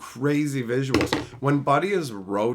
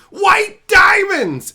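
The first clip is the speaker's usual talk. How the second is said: huge drawn-out shout, very high; yelling; 14 dB louder, much slower than usual. Retold or imitated. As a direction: bellow it slowly in a mock attack cry. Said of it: "while yelling".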